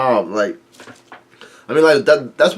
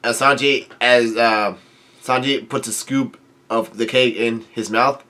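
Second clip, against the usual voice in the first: usual speaking voice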